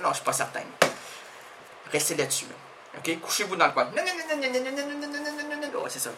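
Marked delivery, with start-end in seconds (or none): 3.8-5.8 quieter engine-like non non; motor imitation. Guest imitating an old car motor for the host. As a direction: hum the no's like an idling old engine. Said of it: "faites comme un vieux moteur".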